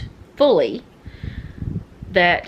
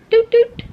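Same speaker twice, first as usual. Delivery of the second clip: two short, loud, high toots; high "doot, doot"; 8 dB louder than usual. Real, not acted. Prompting Noah for an answer, high tooting call. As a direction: toot it out high like a little horn. Calls for attention with sounds.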